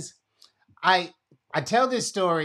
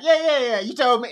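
impatient brush-off; 8 semitones higher, 7 dB louder, faster than usual. Retold or imitated. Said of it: "'Cause I'd be like".

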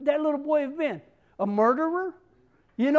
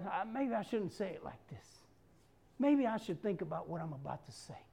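calm thinking aloud; 8 semitones lower, 12 dB quieter than usual. Retold or imitated. Calm self-talk example.

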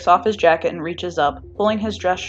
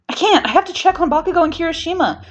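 frantic protest; 11 semitones higher, 5 dB louder than usual. Acted. Pulling away, frantic to help.

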